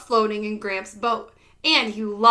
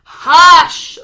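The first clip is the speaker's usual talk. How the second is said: a huge loud crowd hush; the whole crowd shushes him at once; whole town scolding; 4 semitones higher, 15 dB louder than usual. A narrator's reading. The whole town scolding Holler, huge loud hush. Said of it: "the whole town scolded".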